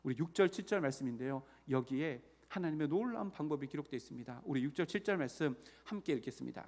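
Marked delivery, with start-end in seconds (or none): none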